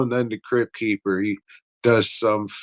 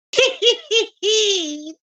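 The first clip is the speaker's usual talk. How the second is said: cackle. Pretend. Crypt Keeper style intro cackle.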